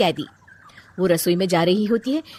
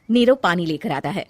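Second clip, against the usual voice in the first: usual speaking voice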